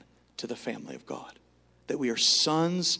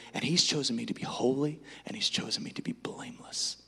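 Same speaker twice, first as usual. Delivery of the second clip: whispered confession; 6 dB quieter than usual. Acted.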